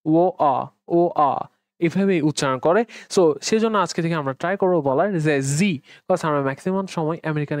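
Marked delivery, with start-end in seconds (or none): 0.1-1.5 British water with the t dropped; British glottal accent. Shown as a demonstration. Teacher imitating British speakers, t-less "ও আ". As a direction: break "ও আ" in two, British glottal stop. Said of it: "টি ই উচ্চারণই করে না".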